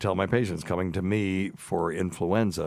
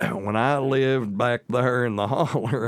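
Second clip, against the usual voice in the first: exaggerated Southern hillbilly drawl, louder and slower; parody Southern accent; 5 semitones higher, 5 dB louder, slower than usual. Shown as a demonstration. Doctor showing how he talked back in the holler, parody Southern accent. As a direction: lay the back-home Southern twang on thick. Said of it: "a little bit of a parody".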